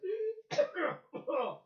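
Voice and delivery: harsh mechanical cough; imitated rasping cough. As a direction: cough like a sick cyborg. He's coughing all the time.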